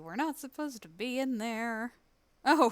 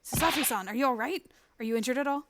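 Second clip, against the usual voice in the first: alarmed, louder and higher; 7 semitones higher, 10 dB louder than usual. Acted. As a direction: cry out as the ship lurches. Ship lurching, alarm.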